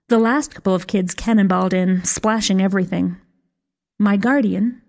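usual speaking voice

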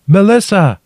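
high, loud shout of a name. A narrator's reading. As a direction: shout down to someone trapped. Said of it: "I shouted".

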